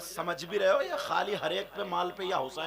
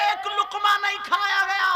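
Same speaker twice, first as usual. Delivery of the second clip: anguished cry; 12 dB louder than usual. Acted. Hafsa's remorseful outburst.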